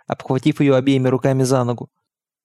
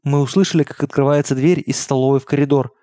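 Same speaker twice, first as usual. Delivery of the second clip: ordinary delivery, nothing marked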